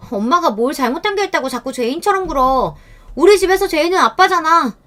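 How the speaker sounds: rising high, tearful outburst; a girl blurting the family secret; upset daughter. A narrator's reading. Daughter bursts out defending mother.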